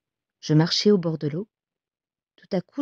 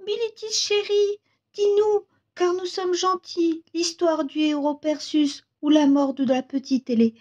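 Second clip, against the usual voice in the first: put-on very high voice of little children begging; pleading children's voice; 9 semitones higher than usual. A narrator's reading. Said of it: "ils me supplient avec les". (voix aiguë d'enfants qui supplient)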